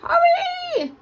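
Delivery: very high, loud squawk; shrieking bird alarm; cockatoo squawk. A narrator's reading. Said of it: "squawked the cockatoo".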